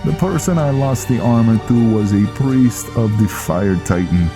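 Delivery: Viking defending himself. Acted. Viking promising Velen the armor is safe, animated.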